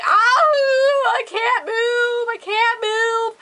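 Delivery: high, drawn-out imitation wail; mock bawling. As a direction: act out a slow, helpless cry. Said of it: "I was bawling my eyes out".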